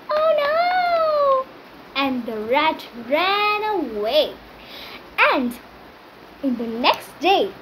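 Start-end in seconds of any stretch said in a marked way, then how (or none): 0.1-1.5 high squeal of tiny rat panic; squeaky rat alarm. Acted. Rat panics as the lion threatens to kill, high squeak. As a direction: yelp it like a scared little rat.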